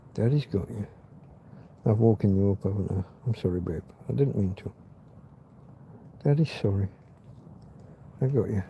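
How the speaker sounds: soft, low tender murmur. Real, not acted. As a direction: gently settle her down. Apologising to woken dog.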